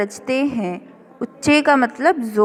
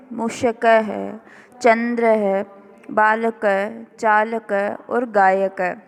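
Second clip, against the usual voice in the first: each word read out slowly, one by one; slow careful word reading; slower than usual. Shown as a demonstration. Teacher reading vocabulary slowly for students to repeat. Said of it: "आपको सिर्फ इनका उच्चारण करना है".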